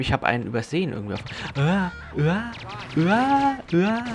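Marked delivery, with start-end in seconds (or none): 1.6-4.0 mock panicked wails. Enemies attack the player.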